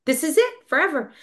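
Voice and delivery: high-pitched retold dismay; mock despair. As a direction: lament it as a hammed-up catastrophe. Retold despair over birthday cake.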